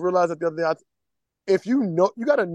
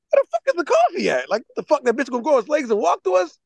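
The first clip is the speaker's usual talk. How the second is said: high-pitched, impatient phone rant; brash demand; 6 semitones higher than usual. Retold or imitated. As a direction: demand it brashly, as a retold outburst. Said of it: "that's my brash delivery".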